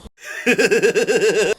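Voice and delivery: hammed-up mocking laugh; giant's mocking laugh. Giant Goliath laughing at young David, mock laugh. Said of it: "The giant laughed at him".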